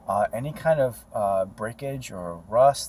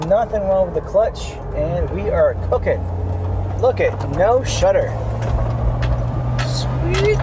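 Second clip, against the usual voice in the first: excited; 7 semitones higher, 6 dB louder than usual. Real, not acted. Celebrating fixed clutch.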